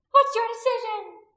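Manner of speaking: high, loud demanding cry. A narrator's reading. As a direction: shout the question over a crowd. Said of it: "Anomia cried out".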